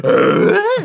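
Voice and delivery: high, loud "Uh?" grunt imitation; Tim Allen grunt. Retelling his contest entry, a loud high grunt. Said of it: "a Tim Allen grunt competition".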